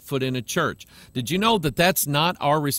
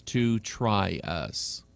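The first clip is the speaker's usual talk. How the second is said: slow hushed end; 6 semitones lower, 5 dB quieter, much slower than usual. Real, not acted. Repeating the solemn warning.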